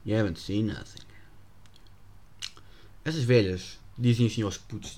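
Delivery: normal talking voice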